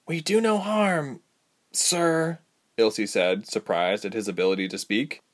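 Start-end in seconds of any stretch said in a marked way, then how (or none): 0.1-2.4 high, slow, careful plea; higher young heroine voice, placating; pleading captive. A narrator's reading. Ilse to the tabby leader, pleading.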